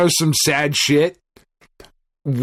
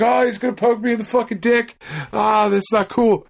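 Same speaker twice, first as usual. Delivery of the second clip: high, fast mock panic as the claw comes at you; panicked rider; 9 semitones higher than usual. Rider freaking out at the 3D villain, high and fast. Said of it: "You're like".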